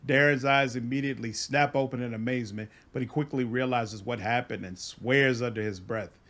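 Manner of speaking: ordinary delivery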